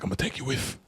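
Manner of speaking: put-on soft British accent with F for TH; hushed attempt at a London accent; badly faked accent. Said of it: "When they say, like".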